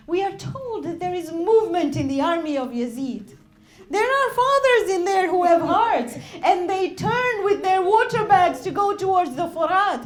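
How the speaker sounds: loud, fervent sermon delivery; impassioned preaching. Acted. Preacher voicing Imam and mother at Karbala, loud. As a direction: push every line with grief.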